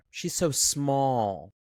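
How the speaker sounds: slow, drawn-out catty sneer; slithering mean girl. Retold or imitated. Miss Judy belittling Angela, slow catty sneer. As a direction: stretch so small out like a snake. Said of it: "comes slithering up and says".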